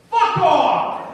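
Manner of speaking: high, full-volume angry shout; assertive yell. Acted. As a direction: blast it out like a tough New Yorker. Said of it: "I really wasn't that, uh, assertive".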